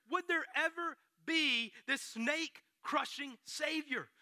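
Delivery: usual speaking voice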